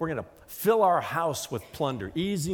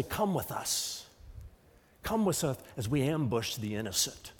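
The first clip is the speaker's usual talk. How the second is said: menacing hushed invite; 2 semitones lower, 6 dB quieter than usual. Acted. Voicing terrorist recruiters.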